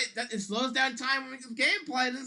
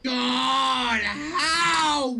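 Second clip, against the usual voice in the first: angry shouting; 2 semitones higher, much slower than usual. Real, not acted. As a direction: yell your fury at the screen. Raging at the game.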